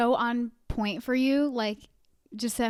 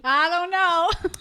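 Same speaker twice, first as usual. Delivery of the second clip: slow, laughing imitation of the shrug; laughing mimicry; 6 semitones higher, 4 dB louder, slower than usual. Friend laughing while mimicking the shrugging Uber driver. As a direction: giggle through it.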